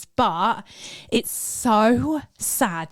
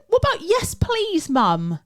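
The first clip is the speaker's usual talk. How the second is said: high, pointed correction; indignant prompt; 9 semitones higher than usual. Retold or imitated. Mum prompts her daughter to say please, high and indignant. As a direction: teach her manners with a jab. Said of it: "I went".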